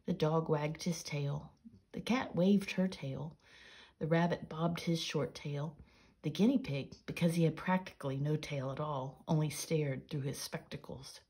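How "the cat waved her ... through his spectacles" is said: usual speaking voice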